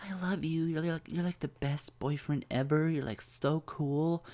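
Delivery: high, soft imitation of an adoring girlfriend; mock lovey-dovey falsetto; gushing girlfriend. Gushing girlfriend before she suddenly flips.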